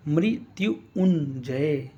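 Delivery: मृत्युंजय drawn out very slowly, each syllable apart; मृत्युंजय stretched out like a teacher dictating; slow syllable drill. Shown as a demonstration. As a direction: sound out मृत्युंजय slowly for spelling. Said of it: "यानी कि आप इस तरह".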